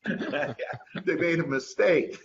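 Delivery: high-pitched, laughing through the words; real giggly amusement. Real, not acted. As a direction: joke through your own laughter. Joking about surprise revenue checks.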